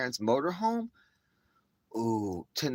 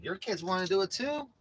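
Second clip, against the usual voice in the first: put-on innocent kid; 9 semitones higher than usual. Innocent reply to angry parents.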